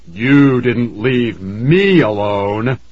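slow, drawn-out furious retort; raging villain. A narrator's reading. Ghost throws the mother's plea back, in rage. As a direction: rage through each stretched word. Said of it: "screamed in a sudden rage".

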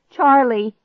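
loud, high cry. A narrator's reading. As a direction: call out in alarm. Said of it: "I cried out".

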